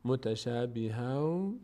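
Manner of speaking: slow "متشابها" ending in a, no n; correct reading. Shown as a demonstration. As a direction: say "متشابها" slowly, dropping the n. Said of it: "نه".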